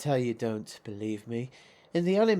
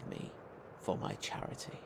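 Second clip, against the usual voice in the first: for my charity, breathed out low and voiceless; whispered gloat; 14 semitones lower, 8 dB quieter than usual. Acted. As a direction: whisper it like a cruel secret. Gloating villain, breathy finish.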